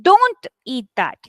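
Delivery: slow, with "Don't" hit first and held; stress on DON'T. Shown as a demonstration. Teacher demonstrating negative auxiliaries, "Don't" stressed. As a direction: punch DON'T, then ease off. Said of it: "trying to stress on don't".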